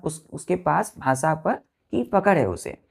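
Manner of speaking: normal talking voice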